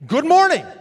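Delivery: bright high loud good morning; cheerful greeting. Acted. Jesus greeting the women, loud and cheerful. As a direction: beam as you greet them. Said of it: "demonstrative greeting".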